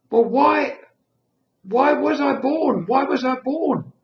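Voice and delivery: nurse, near tears. Retold or imitated. Nurse voice, crying heard.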